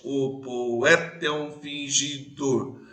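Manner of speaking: "O poeta é um fingidor" slow, hitting the third syllable; stress on the third syllable. Shown as a demonstration. Teacher to class, showing the stressed syllable slowly. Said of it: "a terceira sílaba é mais forte".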